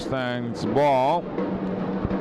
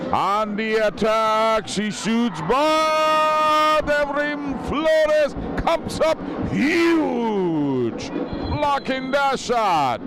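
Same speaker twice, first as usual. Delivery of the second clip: excited save call; 9 semitones higher, much slower than usual. Real, not acted. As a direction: shout the big save. Big save, live play-by-play.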